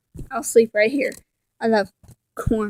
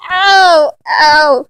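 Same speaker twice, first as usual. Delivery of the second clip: pained yelps; 4 semitones higher, 5 dB louder than usual. Acted. Cat hurt, crying out.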